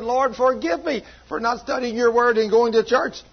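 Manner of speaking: high-pitched retold plea for forgiveness; pouring heart out. Preacher retells Lisa praying for forgiveness, pouring out her heart. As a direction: plead earnestly, imitating a penitent woman. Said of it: "she was pouring out her heart to the Lord".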